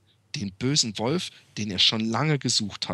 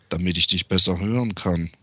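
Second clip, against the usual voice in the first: lower, sly wolf answer; 7 semitones lower than usual. Acted.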